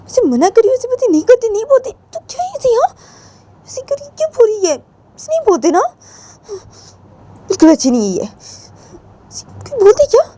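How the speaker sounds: high-pitched indignant outburst; indignant scolding. Acted. (ऊँची आवाज़ में नाराज़ डाँट)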